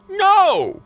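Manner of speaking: loud, high "No"; retold outburst of annoyance; exasperated shout. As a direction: yell it as a fed-up denial. Answering bank's scam question.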